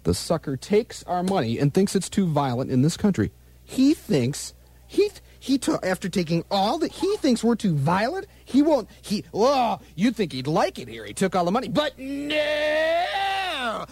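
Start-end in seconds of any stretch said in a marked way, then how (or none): 3.6-13.8 high, building hammed-up outrage ending in shouts; mock rant. DJ ranting at [name] for listeners, building to a high mock shout. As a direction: work yourself into a shrieking comic fury.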